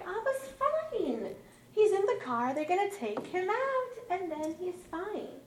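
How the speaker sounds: high, drawn-out singsong reassurance; reassuring. Retold or imitated. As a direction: soothe worried kids in a lifted voice. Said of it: "I reassure them that everything's fine".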